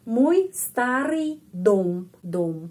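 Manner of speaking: Moj starý dom said very slowly; slow clear demo. Shown as a demonstration. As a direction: spell it out slowly, word by word. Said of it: "huruf O ini mendapat penekanan". (diucapkan sangat pelan dan jelas)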